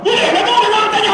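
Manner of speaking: very high, irritated mimicry; imitating a tense, snappish boss; mock tense outburst. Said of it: "tension tu dia".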